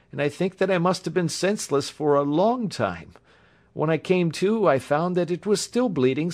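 high-pitched voice, earnestly recounting; injured young engineer. A narrator's reading. Patient telling the doctor how he bound his wound, earnest.

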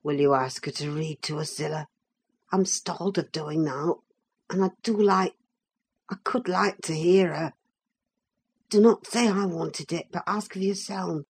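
low, hushed confiding voice; whispered request. A narrator's reading. Shy cousin asks the housekeeper a favour, in a low whisper. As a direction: keep it secret, soft and deep. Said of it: "crossed over and whispered to me".

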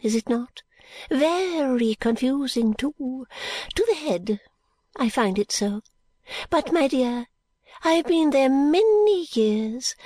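put-on high, fluttery old lady, whispering bits; a rambling old woman clinging to hope; old Miss Flite.

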